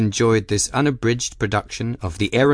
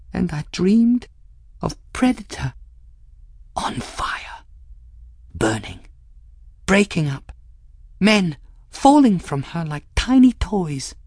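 halting, high, haunted voice; haunted, broken into short phrases; partly whispered; 9 semitones higher than usual. A narrator's reading. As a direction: drift in and out of a whisper. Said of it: "before finishing in a whisper".

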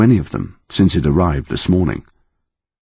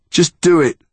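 said aloud, higher and louder, pushing the words out; 12 semitones higher, 4 dB louder than usual. A narrator's reading. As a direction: blurt it aloud, daring him. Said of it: "saying it out loud".

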